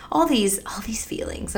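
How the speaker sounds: whispered, overcome. Real, not acted. Wife recalling overwhelming feelings late at night, whispered. Emotional recollection of loss.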